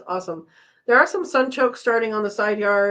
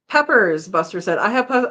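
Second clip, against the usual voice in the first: ordinary talk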